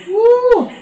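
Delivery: high whooping cheer; excited cheer. Real, not acted. Cheering mid exercise count.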